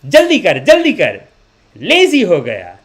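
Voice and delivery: loud, high Indian mother ordering workers; bossy mom impression. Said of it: "so she's gonna say".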